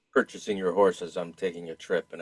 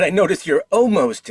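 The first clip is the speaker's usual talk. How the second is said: raised, higher repeat so he hears; repeating a remark louder for the merchant; 11 semitones higher, 12 dB louder than usual. Real, not acted. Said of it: "I said".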